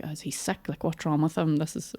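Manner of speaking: a private worried aside, barely voiced; hushed worried thought. Worried thoughts about quiet partner.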